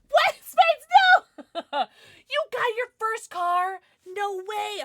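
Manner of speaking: high, loud squeal of surprise; excited disbelief. Real, not acted. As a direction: shriek with delight at the news. Viewer announces first car.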